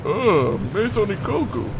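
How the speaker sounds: normal talking voice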